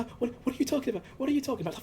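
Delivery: high, flustered stammer; acted panic. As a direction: imitate someone panicking when accused.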